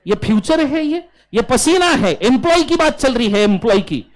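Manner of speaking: exasperated scolding. Real, not acted. Teacher scolds students for a wrong answer, loud and exasperated. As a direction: scold them for saying future.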